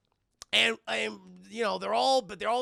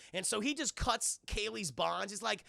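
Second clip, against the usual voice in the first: ordinary talk, nothing marked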